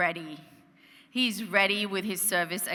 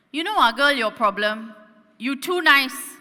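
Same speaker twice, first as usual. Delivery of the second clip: loud, high Singaporean uncle lecturing; blunt cabbie giving advice; Singlish taxi uncle; 5 semitones higher, 9 dB louder than usual. Retold or imitated. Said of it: "and he says".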